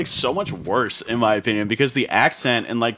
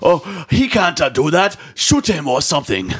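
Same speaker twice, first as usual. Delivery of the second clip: put-on higher, louder alien viceroy accent; Nute Gunray impression; 7 semitones higher, 5 dB louder than usual. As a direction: panicky viceroy voice, stretched vowels. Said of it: "literally a line from the second one".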